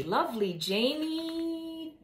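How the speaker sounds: plain reading voice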